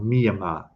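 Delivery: Myanmar with MEE up front, falling at the end; pronounced MEE-uh-mah. Shown as a demonstration. Streamer correcting the name for viewers, Myanmar as MEE-uh-mah. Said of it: "I think it's pronounced".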